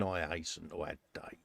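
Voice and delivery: soft whispered aside. Real, not acted. [name] whispering an aside about the chilly morning. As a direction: breathe it as a throwaway aside. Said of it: "aside whisper".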